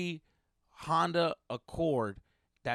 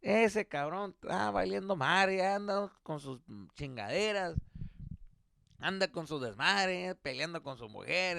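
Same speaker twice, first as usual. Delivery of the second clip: raised-pitch comic neighbor voice; paisa neighbor venting; 5 semitones higher than usual. Retold or imitated. Paisa neighbor venting to dad about his son, higher-pitched. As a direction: complain on and on in a higher voice. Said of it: "the guy's just like".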